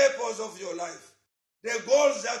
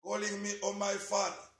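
softer prayer over music, repeating pleas; fervent prayer; 2 semitones lower, 6 dB quieter than usual. Acted. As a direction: pray aloud, leading the church to God.

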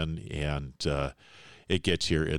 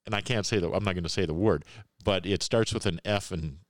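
normal talking voice

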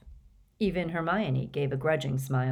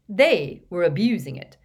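louder and higher, hearty and forceful Ron; robust, rising indignation; 6 semitones higher, 7 dB louder than usual. A narrator's reading. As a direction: push it out boldly in Ron's defence of Harry. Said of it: "he said robustly".

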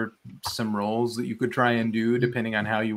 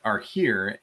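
ordinary talk, nothing marked

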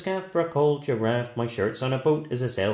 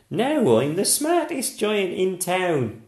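proudly; 8 semitones higher, 6 dB louder, slower than usual. A narrator's reading. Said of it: "he said proudly".

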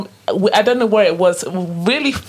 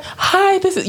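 mock work voice; 9 semitones higher, slower than usual. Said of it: "whatever voice you came with".

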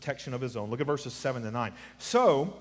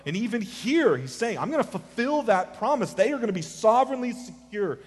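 high, urgent sermon delivery; animated pulpit proclamation; impassioned preaching; 7 semitones higher than usual. Real, not acted. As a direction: press the point home with raised pitch. Preacher rises to full force.

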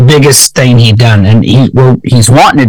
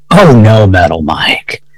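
low mocking voice of fate; a lightly voiced smug fate; 6 semitones lower than usual. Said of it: "the fates go".

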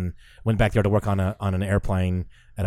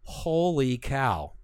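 slow, breathy awe, softer; awed; 4 semitones higher, 4 dB quieter, much slower than usual. Retold or imitated. In awe of the Tesla's speed, slow softer wonder. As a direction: let the wonder drag out slowly. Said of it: "It was like".

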